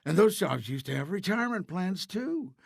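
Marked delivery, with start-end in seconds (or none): none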